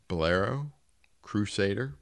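ordinary delivery

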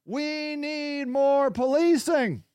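a hint of acted public appeal; lightly voiced community plea.

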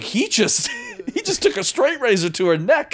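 high-pitched, incredulous laughing retelling; laughing disbelief. Retold or imitated. Film fan recounting the razor scene, laughing in disbelief. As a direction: crack up in shocked disbelief.

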